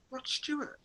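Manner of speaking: mock colleague naming the star; colleague's reply. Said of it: "He's going".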